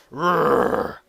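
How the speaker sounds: loud mock growl; manly growl. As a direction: growl like a brute. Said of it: "incredibly manly voice".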